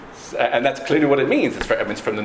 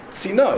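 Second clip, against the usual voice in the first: Sina with the final "na" stressed; stress on second syllable; 7 semitones higher than usual. Shown as a demonstration. Teacher explaining the shield meaning, Sina stressed at the end. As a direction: land on the last syllable of Sina. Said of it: "the emphasis needs to be on the first syllable".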